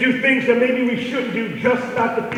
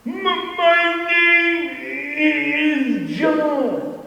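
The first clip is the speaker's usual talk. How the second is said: halting stuttered start, much higher, very slow, sing-song end; imitating a stutter; 6 semitones higher, much slower than usual. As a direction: act out a shy boy stuttering his name. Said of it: "I don't say this ever to make fun".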